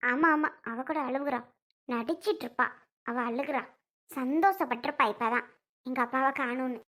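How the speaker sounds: low, soft sarcastic grumble; scornful aside. Acted. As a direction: sneer under your voice at her fake tears. Daughter sneers aside at sister-in-law's tears.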